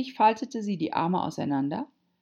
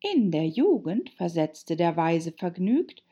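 put-on high-pitched girl reciting verse; high, measured poem recital; Alice reciting; 5 semitones higher, 3 dB louder than usual. A narrator's reading. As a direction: recite it like a schoolgirl.

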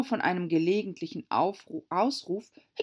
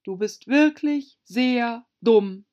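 loud, slow, higher scolding; annoyed; 6 semitones higher, 9 dB louder, slower than usual. A narrator's reading. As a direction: spell out the insult slowly and crossly. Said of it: "verärgert". (verärgert, laut und langsam)

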